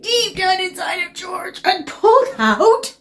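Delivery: ordinary delivery, nothing marked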